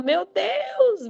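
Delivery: high, drawn-out amazed cry; amazed exclamation. Retold or imitated. Someone looking back at their progress, amazed. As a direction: imitate delighted astonishment, pitch held up high.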